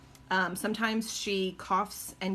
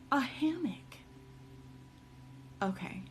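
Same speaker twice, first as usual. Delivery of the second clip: whisper; 5 semitones higher, 6 dB quieter than usual. Real, not acted. Awed whisper at the idea.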